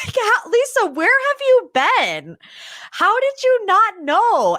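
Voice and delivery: high-pitched incredulous questioning; laugh, then demand answers in high disbelief; mock exasperation. Fan scolding Lisa for missing the rumors, mock exasperation.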